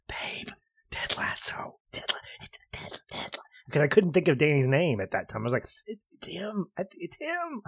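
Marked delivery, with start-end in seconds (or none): 0.1-3.7 hushed whisper in a dark theater. Retold or imitated. Whispering to his wife in the movie theater, hushed. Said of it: "I leaned over and said". 5.9-7.6 squeaky high, hushed excitement; excited. Retold or imitated. Telling his wife the actor is here, high excited squeal. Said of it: "I was so excited".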